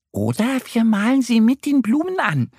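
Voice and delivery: high-pitched duck character voice; suggesting little duck. A narrator's reading. Annie the duck proposing an idea, eager suggestion. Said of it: "schlägt Annie vor".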